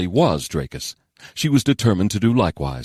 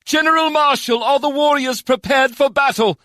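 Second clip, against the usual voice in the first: high, commanding shout; raised, high-pitched warrior's demand; 4 dB louder than usual. A narrator's reading. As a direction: call out high to the troops. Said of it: "the lyric shouted from the back of her dragon".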